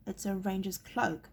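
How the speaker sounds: ordinary delivery, nothing marked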